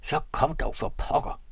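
impatient, half-voiced angry snap; angry call. A narrator's reading. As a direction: hurry him up, irritated. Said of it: "og han råbte vredt".

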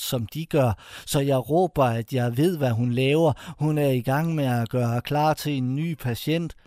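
ordinary delivery, nothing marked

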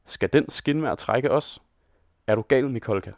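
ordinary delivery

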